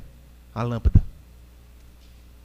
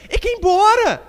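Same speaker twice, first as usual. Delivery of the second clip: tag indignado; 4 dB louder than usual. Real, not acted. Preacher exclaims over fleeing husbands.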